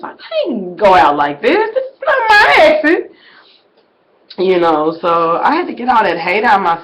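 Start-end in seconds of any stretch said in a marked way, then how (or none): 0.0-3.1 mock distress. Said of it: "I said".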